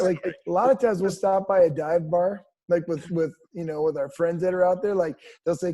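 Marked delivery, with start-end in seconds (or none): none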